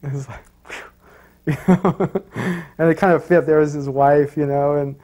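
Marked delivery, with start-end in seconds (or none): 0.0-2.1 breathy laughing whew. Real, not acted. Laughing reaction to the rebuke.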